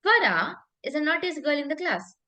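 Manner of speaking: Farah set apart and punched; stress on Farah. Shown as a demonstration. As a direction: lean hard on the name Farah. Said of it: "if I emphasize on".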